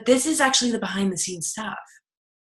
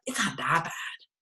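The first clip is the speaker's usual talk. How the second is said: soft, much quieter, near tears; tearful minimizing; 5 semitones lower, 11 dB quieter than usual. Retold or imitated. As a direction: shrink it down to a tearful hush. Quoting own minimizing self-talk.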